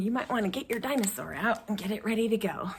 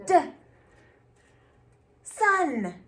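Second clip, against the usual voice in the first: usual speaking voice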